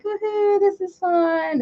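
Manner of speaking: high, mock-excited woohoo, then slow; mock thrilled cheer. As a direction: play the thrill-seeker on the ride. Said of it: "I'll look and be like".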